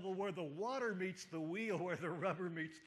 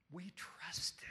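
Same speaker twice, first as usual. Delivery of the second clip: hushed whisper. Acted. Disciples' hopeless words quoted.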